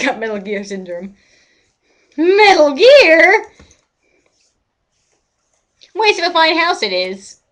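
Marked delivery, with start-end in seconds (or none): 2.2-3.5 much higher, louder, slow incredulous echo; baffled. Real, not acted. 5.9-7.4 higher repeat of the line, hammed-up; hammed-up old man. Repeats NPC line mockingly.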